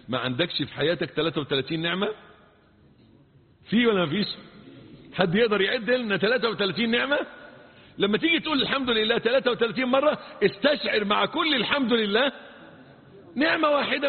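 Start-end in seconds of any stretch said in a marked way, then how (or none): none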